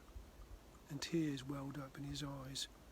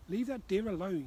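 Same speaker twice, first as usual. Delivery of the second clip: louder, higher telling-off of the dog; scolding shout; 5 semitones higher, 7 dB louder than usual. Acted. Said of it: "shouted Abby".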